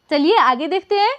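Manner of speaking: usual speaking voice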